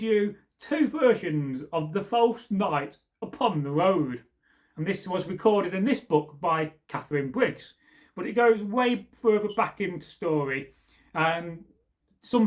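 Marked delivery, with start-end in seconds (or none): none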